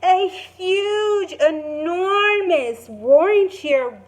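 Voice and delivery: animated read-aloud to a class; excited story reading. Acted. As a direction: read the big moment with rising excitement. Teacher reads story climax aloud.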